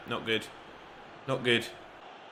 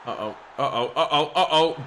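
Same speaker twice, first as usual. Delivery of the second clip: uh-oh; 4 dB louder than usual. Real, not acted. Danger in defence.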